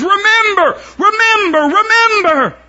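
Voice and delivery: high, loud repeated remember; ringing pulpit cry; shouted command. Acted. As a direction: hammer the word home. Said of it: "It says".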